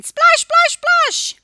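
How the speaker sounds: loud high splashing call; excited splash. Acted. Teacher acts out the duck splashing in the bath. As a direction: shout the splashes out with glee.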